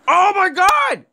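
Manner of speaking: high, loud startled cry of fear; jolted by something charging out; real fright. Real, not acted. Gamer startled by a sprinting monster, frightened cry. Startled by creature in horror game.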